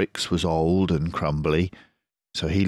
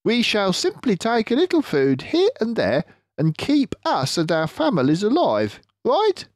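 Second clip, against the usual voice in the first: clever fox leader, pleased with himself; Mister Fox voice; 5 dB louder than usual. Acted.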